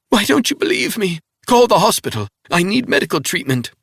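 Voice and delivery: high, loud pleading cry; injured woman pleading; desperate wounded woman. A narrator's reading. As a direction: beg for a hospital, frantic. Woman's held higher voice.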